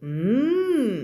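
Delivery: ordinary talk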